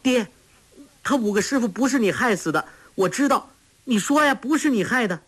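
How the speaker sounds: high, rushed daughter's plea; 急切叫喊. A narrator's reading. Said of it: "黄蓉叫道". (女儿又尖又急地叫喊)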